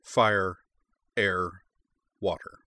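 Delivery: grand, measured legend narration; epic fantasy narrator. A narrator's reading. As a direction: tell the ancient lore like an epic prologue. Opening lore narration before title.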